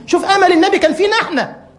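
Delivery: high, loud emotional exclamation; fervent outcry. Real, not acted. Preacher stirring the congregation, high fervent outcry. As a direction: cry it out with awe.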